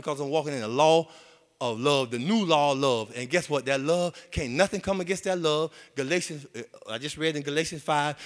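normal talking voice

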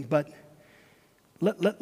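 ordinary talk, nothing marked

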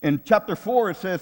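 ordinary talk, nothing marked

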